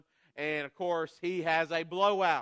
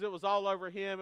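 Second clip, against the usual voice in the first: ordinary talk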